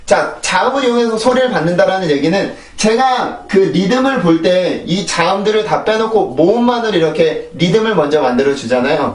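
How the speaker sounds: ordinary delivery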